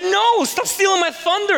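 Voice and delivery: playful protest. Retold or imitated. Joking reply to daughter.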